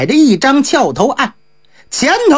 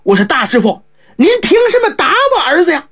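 higher and fast, an alarmed father's protest; father upset that the monk struck his boy; anxious protest; 8 semitones higher, faster than usual. Acted. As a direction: rush it out in a panic for your son. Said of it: "当时就急了".

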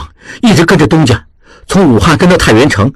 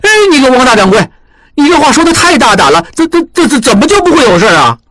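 put-on much higher, stammering young apprentice in alarm; higher stuttering protest from the apprentice; apprentice crying out; 10 semitones higher than usual. A narrator's reading. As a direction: protest in a flustered outburst. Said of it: "小栓大叫".

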